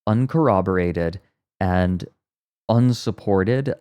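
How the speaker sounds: ordinary talk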